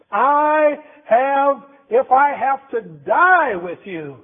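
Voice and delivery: high, forceful vow, drawn out; mock angry shout, slowing for emphasis. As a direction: act out Peter's vehement protest. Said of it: "he shouted at Jesus on the angry side".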